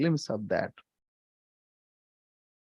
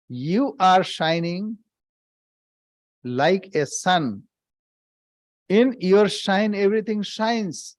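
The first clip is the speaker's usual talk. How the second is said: slower, each phrase weighed and spaced; slow deliberate repeat. Shown as a demonstration. Swami repeating the line for his listeners, slow and deliberate. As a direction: repeat it slowly so it sinks in. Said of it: "Let me repeat".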